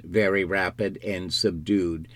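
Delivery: ordinary delivery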